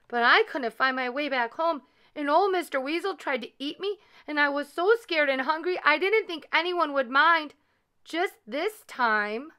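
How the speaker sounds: high, pleading little voice; tearful youngster. A narrator's reading. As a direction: plead your excuse as a scolded child. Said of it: "As the tears flowed down Leola's face".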